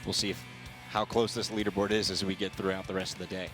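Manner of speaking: ordinary talk, nothing marked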